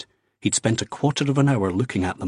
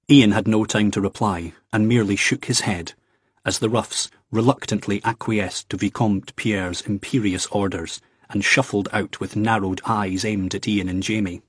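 ordinary delivery, nothing marked

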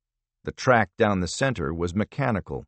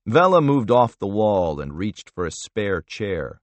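usual speaking voice

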